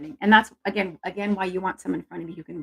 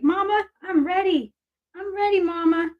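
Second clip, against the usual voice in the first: voicing the dog; 11 semitones higher than usual. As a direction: speak for the dog, begging sweetly in a high pitch. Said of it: "She's like".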